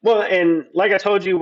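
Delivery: normal talking voice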